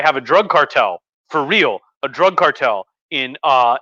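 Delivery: ordinary talk, nothing marked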